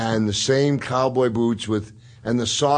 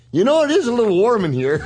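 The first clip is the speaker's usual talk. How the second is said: nervous cop; 8 semitones higher, 3 dB louder than usual. Retold or imitated. Cop backing down from Andre.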